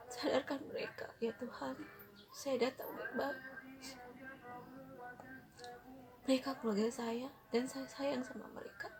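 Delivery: soft, tearful plea to God, voice close to crying; tearful prayer. Retold or imitated. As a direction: pray through tears, asking softly. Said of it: "saya lagi menangis". (doa lirih sambil menangis)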